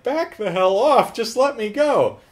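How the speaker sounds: high, slow mock plea; drawn-out, high-pitched protest; desperate warning. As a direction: act out a cornered man warning someone off. Acts out cornered man with gun.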